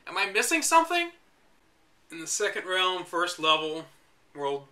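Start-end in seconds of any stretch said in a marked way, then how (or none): 0.1-1.1 high, incredulous pitch; baffled question. Real, not acted. Puzzling over the strategy guide, baffled question.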